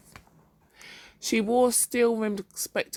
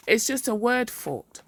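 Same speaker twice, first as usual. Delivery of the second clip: slow, emotionless statement; without emotion; 6 semitones higher, 4 dB louder than usual. A narrator's reading. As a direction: say it slowly, no feeling. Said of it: "without emotion".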